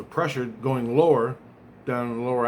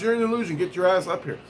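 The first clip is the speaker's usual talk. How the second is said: higher-pitched quoted order; raised-pitch retold command; retold summons; 6 semitones higher, faster than usual. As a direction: imitate someone calling you up urgently. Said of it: "and he goes".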